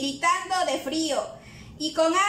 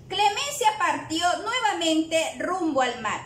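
plain reading voice

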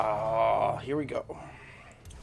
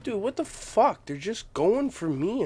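high, loud outraged yell; furious at the game; angry gamer shout; 9 semitones higher, 5 dB louder than usual. Real, not acted.

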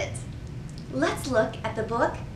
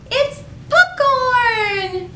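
high, slow, drawn-out cheer; triumphant high shout of popcorn; excited finale; 13 semitones higher, 3 dB louder, much slower than usual. Shown as a demonstration. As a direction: land the big reveal for the kids. Rhyme's big finish.

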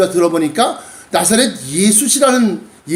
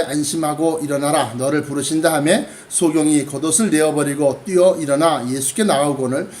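measured, lower-pitched Bible reading; scripture reading; 6 semitones lower than usual. Acted. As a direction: read the passage aloud, evenly and formally. (차분한 성경 봉독)

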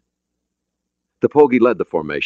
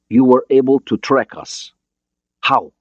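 put-on higher, Russian-accented questioning; foreign accent; 7 semitones higher, 4 dB louder than usual. Borodin asking how he was tracked, Russian accent. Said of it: "He had an accent, but his English was understandable".

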